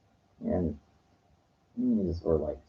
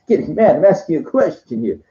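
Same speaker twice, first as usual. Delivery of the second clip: much higher, louder mock New York indignation; indignant deli guy; 9 semitones higher, 4 dB louder than usual. Deli worker snapping back at the confused customer, much higher and louder. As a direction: fire it back like an offended counterman. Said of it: "and he goes".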